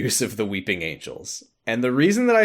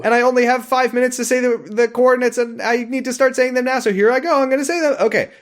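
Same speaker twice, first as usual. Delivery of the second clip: frantic rush; 11 semitones higher, 4 dB louder than usual. Pretend. Mock frantic recorded message.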